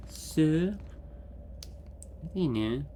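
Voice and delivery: ordinary delivery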